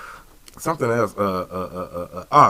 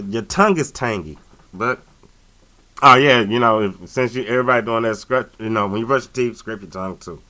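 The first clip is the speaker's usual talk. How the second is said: normal talking voice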